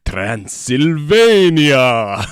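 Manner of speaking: put-on Transylvanian count accent. Count's haunted-castle intro.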